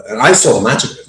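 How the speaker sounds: usual speaking voice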